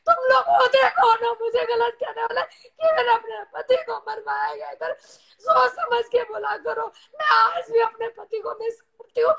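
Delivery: real tearful outpouring, breaking into sobs; crying. Real, not acted. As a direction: cry out your grief and exhaustion to those accusing you. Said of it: "नहीं, रो मत।". (फूट-फूटकर रोते हुए)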